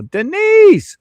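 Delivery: high loud call of the name. Real, not acted. Streamer hails viewer Denise, loud high call. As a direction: hail her across the room.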